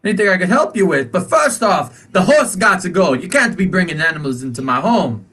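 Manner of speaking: sweetly ushering, then shooing the horse; fussy shopkeeper. Acted. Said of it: "But he's like".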